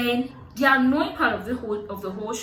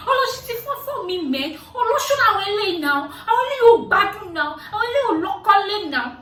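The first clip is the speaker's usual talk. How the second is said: high, loud, fast mock rant in Yoruba; mock shouted rant; 11 semitones higher, 8 dB louder, much faster than usual. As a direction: fire off the husband's insults fast and loud. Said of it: "the idiot started shouting".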